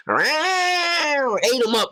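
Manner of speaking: drawn-out high wail. Pretend. Beetle story punchline climax.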